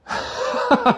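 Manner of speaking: a quick high burst of laughter; sudden high laugh. Real, not acted. Youtuber laughs at the author's claim, sudden high laugh. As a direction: laugh out in disbelief.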